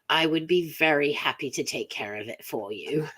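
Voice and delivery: put-on English accent, polite and obliging. Shown as a demonstration. Host pitching for voice work, English accent. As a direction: offer your services in an English accent. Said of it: "with an English accent".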